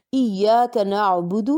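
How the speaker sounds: ایاک نعبد recited slowly with a clear ع; slow careful ایاک نعبد, every letter full; correct recitation. Shown as a demonstration. Teacher correcting common Fatiha mistakes, slow exact recitation. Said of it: "نعبد کو ہم اکثر نعبد پڑھتے ہیں".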